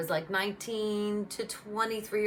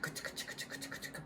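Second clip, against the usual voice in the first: soft, whispered clicks; 15 dB quieter than usual. Retold or imitated. Slow-motion Bionic sound effect.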